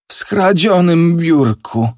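slow, high, horrified repetition; dawning horror. A narrator's reading. Father realizes the ticket was stolen, slow dawning horror. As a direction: repeat it slowly as the dread sinks in. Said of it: "Powtórzył te słowa ciszej, z rodzajem przerażenia".

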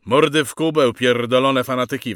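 loud, high angry shout; furious order to shut up; sergeant's yell. A narrator's reading. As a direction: yell abuse at the prisoners. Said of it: "wrzasnął sierżant".

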